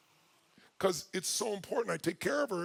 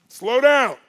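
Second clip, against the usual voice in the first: loud, higher-pitched command; urgent warning shout; 5 semitones higher, 13 dB louder than usual. Retold or imitated. Husband telling his speeding wife, urgent shout.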